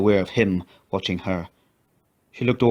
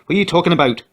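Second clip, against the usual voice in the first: much higher, louder bewildered outburst; alarmed demand; 9 semitones higher, 5 dB louder, faster than usual. A narrator's reading. McGrath confronting Annie about the note, alarmed. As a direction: demand an explanation.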